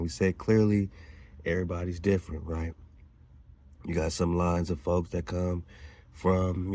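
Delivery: ordinary talk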